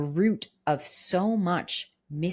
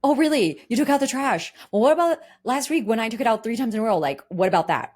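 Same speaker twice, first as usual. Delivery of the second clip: higher, louder incredulous counterattack; indignant partner; 5 semitones higher, 5 dB louder than usual. Retold or imitated. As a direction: escalate the argument, scoffing. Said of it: "And your partner's like".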